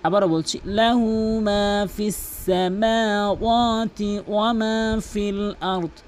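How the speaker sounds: slow, drawn-out melodic tilawat; Quran recitation. Acted. Reciting to viewers as a slow melodic model. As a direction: chant each word long and even.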